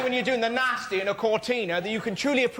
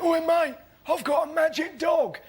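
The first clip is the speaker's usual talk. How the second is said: higher, eager character voice; homeless bloke; 6 semitones higher than usual. Acted. Homeless man calling back the man who gave a pound, eager high voice. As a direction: call out high like an excited bloke. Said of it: "and he went".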